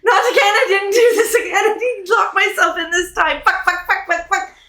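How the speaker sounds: high, rushed self-scolding, laughing through it; panicked trapped woman. Acted. Woman locked below berating herself, high and rushed. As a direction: berate yourself in a squeaky panic.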